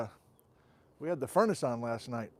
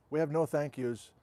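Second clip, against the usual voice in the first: ordinary talk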